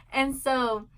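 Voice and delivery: normal talking voice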